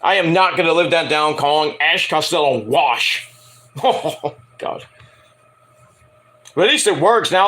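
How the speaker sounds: ordinary talk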